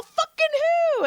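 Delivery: high, exasperated retold question; incredulous demand. Acting out pressing the patient for the comedian's name, incredulous. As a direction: push the retold question up high, bursting to know.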